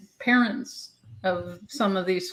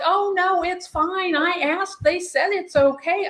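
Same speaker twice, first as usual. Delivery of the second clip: much higher, airy breezy reassurance; high mock parent; 9 semitones higher than usual. Parent brushing off the florist's warning, mock high voice. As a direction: play the clueless cheerful parent. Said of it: "And they're like".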